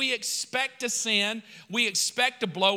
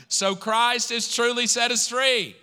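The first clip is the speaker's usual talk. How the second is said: shouted verse; 4 semitones higher, 5 dB louder than usual. Shown as a demonstration. Pastor shouting the verse again for the church, loud proclamation. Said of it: "then I want you to shout".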